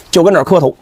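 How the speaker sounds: usual speaking voice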